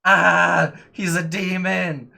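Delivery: panicked high, loud cry; Kalik. Acted. Game master voices a character spotting wings, panicked shout. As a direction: scream the alarm in hammed-up terror. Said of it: "so Kalik is like".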